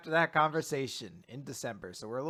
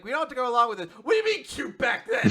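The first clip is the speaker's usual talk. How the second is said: high, loud flustered protest; embarrassed outburst; 10 semitones higher, 8 dB louder, faster than usual. Retold or imitated. Daughter protests her embarrassing photos, high loud outburst.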